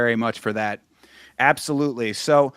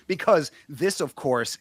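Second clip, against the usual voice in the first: usual speaking voice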